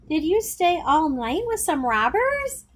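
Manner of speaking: a small sister's eager question, lightly piped. A narrator's reading. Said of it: "My youngest sister piped up".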